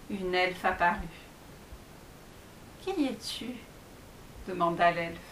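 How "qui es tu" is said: soft elf voice. Acted. Elf appears.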